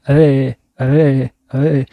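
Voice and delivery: رے drawn out very slowly with a trilled tongue; slow trilled r practice; rolled r drill. Shown as a demonstration. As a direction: stretch رے and اے slowly, tongue vibrating on the r. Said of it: "اس طرح سے بولتے".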